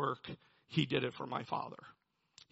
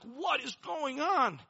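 retold anguished cry; 11 semitones higher, 3 dB louder, slower than usual. Retold childhood outburst at table.

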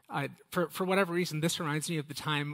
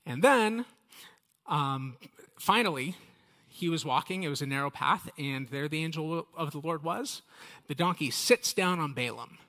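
usual speaking voice

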